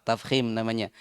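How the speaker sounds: ordinary delivery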